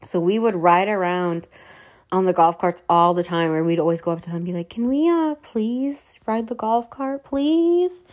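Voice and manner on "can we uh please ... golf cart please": high sweet begging voice, slowed; pleading. Retold or imitated. Asking the staff nicely for a ride, high pleading voice. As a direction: ask like a cute kid who wants something.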